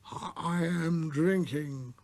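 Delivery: put-on very slow mournful drunk; a gloomy tippler droning; lugubrious. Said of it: "with a lugubrious air".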